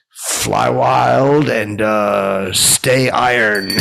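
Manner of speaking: slow, loud, stretched-out catchphrase; drawn-out sign-off. Real, not acted. Host signing off to listeners, slow loud flourish. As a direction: belt it out slowly, holding each word.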